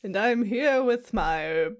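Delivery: high, drawn-out spooky ghost voice; wailing ghost. Acted. Ghost host introducing a podcast to listeners, spooky wail. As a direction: moan the words out slowly like a ghost.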